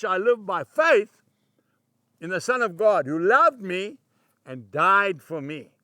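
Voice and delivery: emphatic scripture reading. Acted. Preacher reciting Galatians on dying to self, emphatic scripture reading. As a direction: declare the verse with force, slowing on closing phrases.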